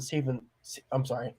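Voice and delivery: drowsy, trailing off; sleepy mumble, losing the word. Real, not acted. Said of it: "You can tell I'm sleepy as hell".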